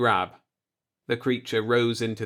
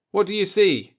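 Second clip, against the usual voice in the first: higher, louder demand; commanding question; 9 semitones higher, 5 dB louder than usual. Acted. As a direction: ask it like a captain testing his men. Captain questioning crew.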